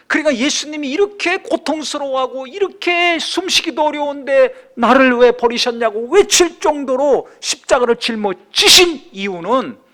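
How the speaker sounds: high, loud, driving sermon delivery; impassioned preaching. Acted. Preacher on Jesus's suffering on the cross, impassioned.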